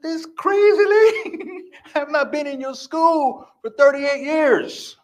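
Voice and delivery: high, slow, laughing through the words; laughing disbelief. Retold or imitated. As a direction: laugh off the absurd detention. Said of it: "laughing at the counselor".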